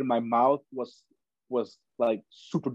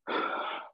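sharp gasp; 7 dB quieter than usual. Retold or imitated. Retold struggle for breath.

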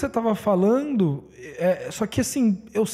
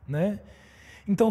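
ordinary delivery

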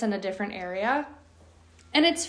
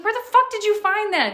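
high, loud, disbelieving shout; shocked yell; 12 semitones higher, 8 dB louder than usual. Retold or imitated. Owner finding a glove in dog's mouth, shocked yell. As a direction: yelp it in outraged surprise. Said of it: "I'm like".

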